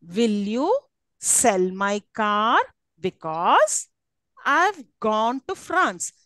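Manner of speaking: slow, "sell", "car", "gone" and "France" stretched; stressed content words. Shown as a demonstration. Teacher fills out the telegram, stressing "sell", "car", "gone", "France". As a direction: lean on "sell", "car", "gone", "France", pausing between phrases. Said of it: "Now, these are stressed".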